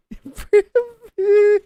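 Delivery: sudden high burst of laughter; loud high laugh. Real, not acted. Streamer cracking up at a chat opponent, loud laugh.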